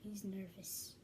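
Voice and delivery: whispered aside. Real, not acted. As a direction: whisper it so he can't hear. Aside about the nervous guest.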